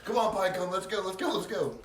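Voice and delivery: loud, high hurry-up call; playful panicked urging; urgent shouting. Acted. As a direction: holler to get a companion running. Fleeing from Kenpachi, urging Pinecone on.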